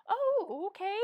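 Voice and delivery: high, loud startled oh, then a short okay; mock surprised reaction; taken aback. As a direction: act caught off guard by an odd introduction. Reacting to stranger's bipolar introduction.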